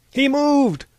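high, loud shout from a crowd; crying out. A narrator's reading. Someone in the crowd sees him move, crying out. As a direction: shout the alarm, pitched high. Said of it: "cried a voice from the crowd".

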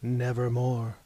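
low, grave and final; raven's reply. A narrator's reading. The captain answering Mahogany, low and ominous. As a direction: intone it like Poe's raven. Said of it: "Quoth the captain".

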